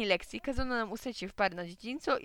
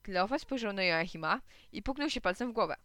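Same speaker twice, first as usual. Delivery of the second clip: ordinary delivery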